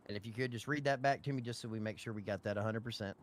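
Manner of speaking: ordinary talk, nothing marked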